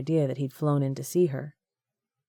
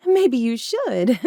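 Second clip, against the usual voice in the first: laughing; 8 semitones higher, 6 dB louder, slower than usual. Acted.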